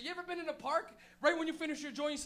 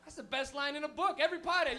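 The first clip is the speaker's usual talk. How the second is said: usual speaking voice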